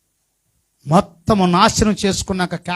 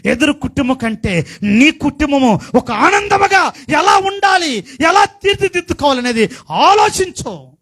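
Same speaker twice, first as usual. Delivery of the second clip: high, loud sermon cadence; fiery preaching; 7 semitones higher, 4 dB louder than usual. Acted. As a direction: preach it out to the congregation. Preaching climax.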